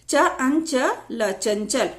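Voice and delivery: slowly, one sound at a time; slow spelling-out. Shown as a demonstration. Teacher breaking a word into sounds, slow spelling-out. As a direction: separate each sound clearly.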